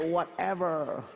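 slow, laid-back Damansara drawl; relaxed whatever. Retold or imitated. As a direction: let it stretch out lazily. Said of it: "dia relax sikit".

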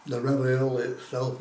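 with food in mouth; talking between bites; chewing food. Real, not acted. Seen 0: Bite taken before; hand covers mouth chewing early, later holds ravioli.

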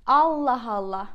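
a fed-up Allah Allah; exasperated. Shown as a demonstration. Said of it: "according to your intonation, the meaning can change".

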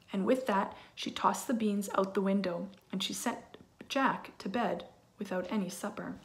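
usual speaking voice

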